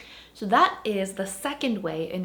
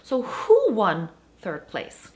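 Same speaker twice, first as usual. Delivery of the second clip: who won third place? said louder and slow; stress on who; 6 dB louder, much slower than usual. Shown as a demonstration. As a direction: hit who and slow the question down. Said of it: "now the focus is on the subject".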